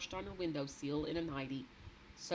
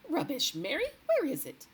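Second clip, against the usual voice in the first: brisk, louder dismissal; dismissive; 10 semitones higher, 7 dB louder than usual. A narrator's reading. The queen scoffing at the maid and demanding the paper. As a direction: brush it off impatiently.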